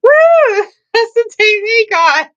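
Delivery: very high, loud squealing woo; starstruck cheer. Retold or imitated. Teen spotting the TV host in Townsville, squealing. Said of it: "I was like".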